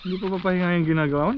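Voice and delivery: rattled off quickly; fast retake. Real, not acted. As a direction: blurt the question fast. (mabilis na pagsasalita)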